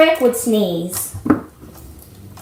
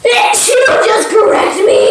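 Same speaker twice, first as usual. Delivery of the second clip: higher, louder indignant challenge; mock outrage; 10 semitones higher, 6 dB louder than usual. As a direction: fake offended challenge. Indignant at correction.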